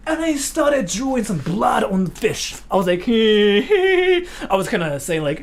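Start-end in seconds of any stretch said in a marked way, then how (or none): 3.1-4.3 impish giggle. Retold or imitated. Mischievous giggle while drawing blood.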